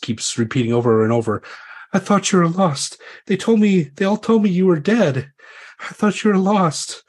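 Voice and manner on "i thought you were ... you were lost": high, pleading and tearful; tearful weeping. Acted. Lady clings to her returned knight, weeping high. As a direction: cry it out in a much higher voice. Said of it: "breaks down weeping and throws her arms around".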